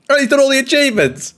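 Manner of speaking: incredulous. Real, not acted. Piling on incredulously.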